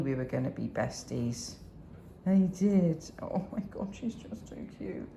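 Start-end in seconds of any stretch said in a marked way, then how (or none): 3.2-5.1 soft unvoiced whisper of delight. Real, not acted. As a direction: breathe it so the dog isn't startled. Whispering over the dog.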